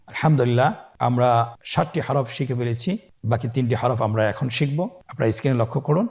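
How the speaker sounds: usual speaking voice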